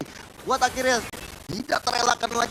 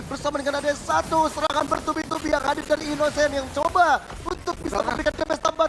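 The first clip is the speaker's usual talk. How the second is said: ordinary talk, nothing marked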